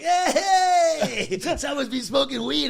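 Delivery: loud, high laughing whoop; whooping and jeering with laughter; rowdy cheer. Retold or imitated. Comedians laughing loudly at his red eyes backstage.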